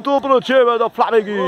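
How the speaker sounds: high-pitched sportscaster proclamation; elated goal-call voice; excited scorer announcement. Real, not acted.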